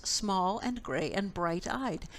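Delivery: plain reading voice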